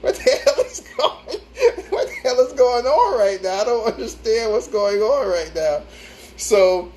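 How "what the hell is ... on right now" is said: high, incredulous what-the-hell, laughing through it; laughing disbelief, pitched up; exasperated confusion. Retold or imitated. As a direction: act baffled and fed up on the call. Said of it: "And I'm like".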